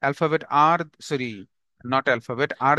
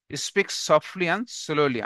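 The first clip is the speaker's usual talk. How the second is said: slow dictation; much slower than usual. Shown as a demonstration. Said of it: "dictating the point slowly".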